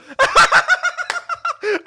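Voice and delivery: loud, high burst of laughter; excited laugh. Real, not acted. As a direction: laugh out in triumph. Opponent choked out, shouting win.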